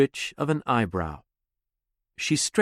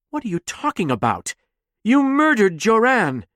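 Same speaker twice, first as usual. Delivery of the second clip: fast, then a high loud accusation; angry accusation; 9 semitones higher, 3 dB louder than usual. Acted. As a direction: throw the murder in her face. Dax accusing Nemi.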